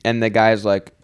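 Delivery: ordinary talk, nothing marked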